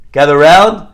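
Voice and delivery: loud, drawn call to the family. Retold or imitated. Father to wife and kids, calling out.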